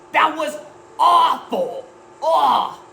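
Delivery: high loud disgusted cry; outraged moviegoer. Acted. Disappointed viewer at the movie's end, loud and high. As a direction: spit it out in disgust.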